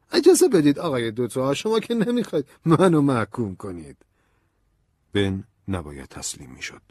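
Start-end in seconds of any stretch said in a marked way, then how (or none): 0.1-4.0 high, loud colonel's voice; protesting colonel. A narrator's reading. Colonel objecting to Ben's questions, high and loud protest. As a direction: protest loudly in a raised pitch. Colonel cuts in on accusing visitor.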